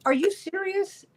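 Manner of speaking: high-pitched disbelieving question; can't believe what they're claiming; incredulous. Retold or imitated. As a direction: shoot up high in mock disbelief. Acted reaction to the G7 claim.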